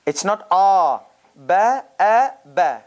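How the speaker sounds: Ba, a, ba with a clean glottal break before the a; glottal stop demo. Shown as a demonstration. Teacher contrasting hamza with a long vowel, crisp glottal stops. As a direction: stop the breath sharply before a, then release. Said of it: "it's a consonant now".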